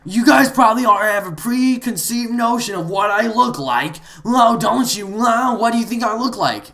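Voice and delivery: high, loud mock indignation; mocking player impression. Gamer retelling an offended player's challenge, mocking impression. Mimics angry player's retold taunts.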